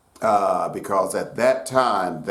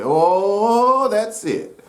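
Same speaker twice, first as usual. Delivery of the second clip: high, drawn-out delighted exclamation; high-pitched "that's the stuff" approval; approving grandmother; 10 semitones higher, 3 dB louder, much slower than usual. Acted. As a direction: voice a grandmother's pleased recognition, pitched up. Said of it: "your great-grandmother hears it, they say".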